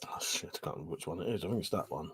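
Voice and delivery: fast hushed whisper to himself; real quick whisper. Real, not acted. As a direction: whisper it quickly under your breath. Muttering to himself off-mic.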